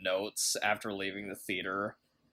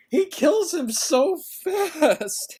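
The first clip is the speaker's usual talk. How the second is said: laughing; 5 dB louder, slower than usual. Acted. Mocking how easy the fight is.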